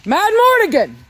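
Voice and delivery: high loud call of the name; calling the dog. Real, not acted. Owner calling her dog Mad Mortigan, high and loud. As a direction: call across the yard.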